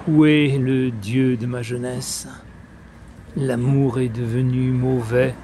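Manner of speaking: solemn verse reading with long pauses between phrases; declaimed French poem, line endings falling away; poetry recitation. Acted. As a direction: recite it phrase by phrase, letting each image resonate. Recites Apollinaire's Le Brasier.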